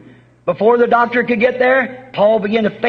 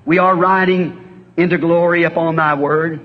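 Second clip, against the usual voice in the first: lower, measured prayer voice; closing altar prayer, unhurried; earnest prayer; 4 semitones lower than usual. Acted. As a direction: pray it humbly to the Father. Closing prayer, heads bowed.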